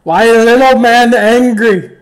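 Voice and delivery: taunting brute Hack. Acted. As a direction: goad the little man like a dumb giant.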